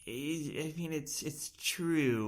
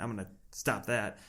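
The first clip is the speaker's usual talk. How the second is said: ordinary delivery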